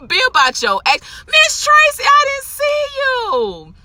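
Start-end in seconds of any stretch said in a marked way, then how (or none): 1.3-3.7 high, drawn-out, slow joyful hello; delighted greeting. Real, not acted. Spotting Miss Tracy walk by, slow high delighted greeting. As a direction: sing out a surprised, happy good morning.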